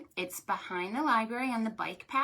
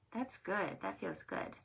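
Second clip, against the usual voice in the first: whispered relief; 3 semitones lower, 10 dB quieter than usual. Real, not acted. Whispered relief during stretch.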